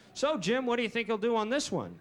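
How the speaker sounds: hammed-up sports broadcast banter; bowling commentator. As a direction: put the question like a TV commentator. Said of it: "commentators in bowling".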